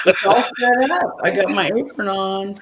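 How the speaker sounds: bright show-host start; excited. Real, not acted. Starting her fudge video for friends, excited.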